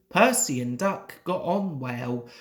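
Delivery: usual speaking voice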